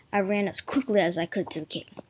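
ordinary delivery, nothing marked

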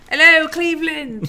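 loud, drawn-out mock-British stage greeting; put-on British arena shout; British accent. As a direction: holler it high and long, like a British rocker greeting the crowd. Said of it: "why you'd develop a British accent".

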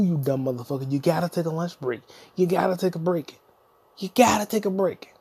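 usual speaking voice

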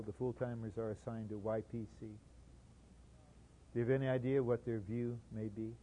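ordinary delivery

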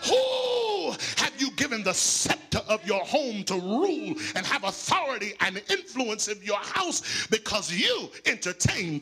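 rising, shouted sermon cadence with music under; preaching. Acted. Preacher pressing the congregation about silence, full-force preaching. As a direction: preach it hard over the organ.

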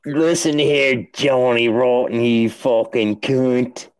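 put-on slow, drawn-out faltering British geezer accent; mock British accent. Friend failing at a cockney accent, slow and hammed. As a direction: try a London accent and lose it. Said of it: "I can't hold an accent".

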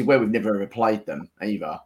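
ordinary talk

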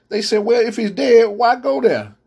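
high, rising sermon retelling; preaching at full force. Acted. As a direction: carry the story up in pitch with fervor. Retelling Lazarus story, raised pitch.

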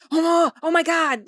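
loud, slow startled outcry; loud drawn-out oh my God; mock panic. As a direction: act out a jolt of panic. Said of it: "but, like".

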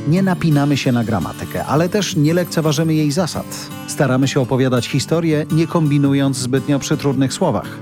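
polished announcer read over music; radio intro read. Acted.

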